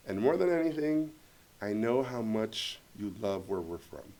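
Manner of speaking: low, soft, deliberate speech; heartfelt reassurance. Retold or imitated. Producer reassuring the director he will make the movie, softly heartfelt. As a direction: speak gently, pausing between phrases.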